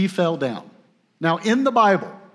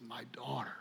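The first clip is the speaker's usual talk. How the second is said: very soft, hushed "My daughter"; tender; 2 semitones lower, 19 dB quieter than usual. Retold or imitated. Boaz tenderly calling Ruth his daughter, hushed and soft. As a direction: lay it down gently like a blessing. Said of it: "He speaks tenderly to her".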